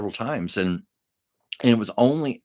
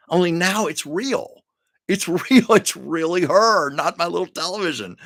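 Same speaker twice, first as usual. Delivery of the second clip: excited disbelief; 8 semitones higher, 6 dB louder than usual. Real, not acted. Overjoyed that meeting was real.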